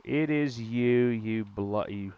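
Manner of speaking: slow, hammed-up English accent; lightly put-on English accent, drawn out; mock English accent. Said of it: "trying to learn about ... England".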